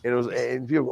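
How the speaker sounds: ordinary delivery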